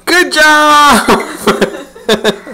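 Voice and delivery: high, squeaky, laughing praise; squeaky praising a dog. Real, not acted.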